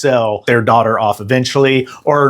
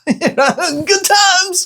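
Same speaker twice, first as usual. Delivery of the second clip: laughing. Real, not acted. Laughing after gross fetus scene.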